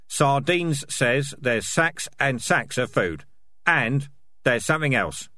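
insistent, heated character voice; tough, angry Dark Tan. Acted. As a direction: press the accusation home word by word.

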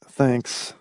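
soft, deflated thanks; dreary. A narrator's reading. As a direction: say it with no hope left. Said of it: "Hamilton said drearily".

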